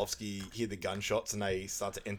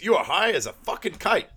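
loud, high disbelieving yell; mum's loud accusation; 9 semitones higher, 7 dB louder than usual. Retold or imitated. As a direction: blast it at him like a furious mum. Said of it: "Mum goes".

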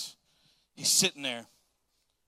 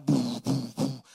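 mouth-made bass thump of party speakers; party bass imitated by mouth; imitated bass boom; 3 semitones lower, 5 dB louder, slower than usual. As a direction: boom it like a subwoofer. Subs are booming down there.

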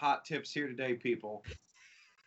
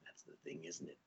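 hushed aside; 13 semitones lower, 14 dB quieter than usual. Acted. Conspiratorial aside about writers.

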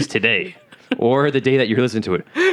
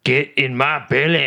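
put-on slow Scottish accent, a bit higher; Fat Bastard impression; 4 semitones higher, 3 dB louder, slower than usual. Friend doing Mike Myers' Scottish Fat Bastard line. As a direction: growl it hungrily in a Scottish accent. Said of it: "That's fat bastard".

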